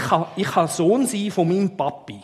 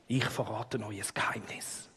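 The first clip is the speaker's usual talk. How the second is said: hushed secretive whisper; tiefe Stimme; 6 semitones lower, 11 dB quieter than usual. Pretend. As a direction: lean in and share a grave secret. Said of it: "mit tiefer Stimme und mit Augenbrauen so nach innen". (geheimnisvoll flüsternd)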